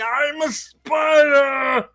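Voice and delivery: very high, stretched-out yell; hammy shrieked monster reveal; mock monster Moghedien. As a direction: scream it slowly like a cartoon villain. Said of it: "fly and be like".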